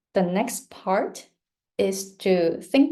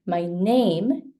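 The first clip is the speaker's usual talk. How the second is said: "My name" with weak my, strong NAME; stress on NAME. Shown as a demonstration. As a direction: lift NAME above "My". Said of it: "name is stronger".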